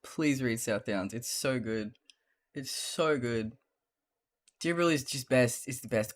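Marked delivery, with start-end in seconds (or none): none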